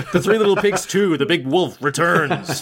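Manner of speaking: hammed-up movie sequel title; mock trailer announcer. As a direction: announce it like a cheesy trailer, slowing down.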